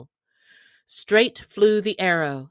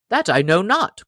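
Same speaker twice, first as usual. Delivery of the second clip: surly reply; 3 semitones higher, 7 dB louder than usual. Acted. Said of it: "quoth the stranger surlily".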